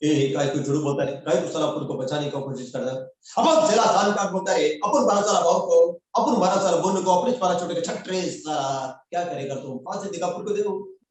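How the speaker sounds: swagger through the tapori film lines; tapori dialogue. Acted. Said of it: "मेरा पहला dialogue था राम जाने का". (राम जाने के टपोरी अंदाज़ में ऊँची, ज़ोरदार डायलॉगबाज़ी)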